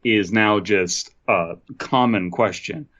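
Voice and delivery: normal talking voice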